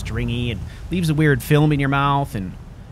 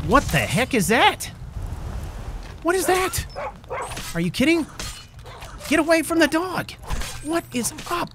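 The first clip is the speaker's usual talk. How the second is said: much higher alarmed cries; shocked yelps. Real, not acted. Player shrieking in shock at a monster near the dog.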